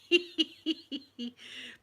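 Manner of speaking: high, wheezy giggling; real giggle. Real, not acted. Remembering the thing that happened, high giggles. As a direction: giggle helplessly at your own story. Amused at own story.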